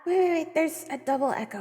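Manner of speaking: ordinary talk, nothing marked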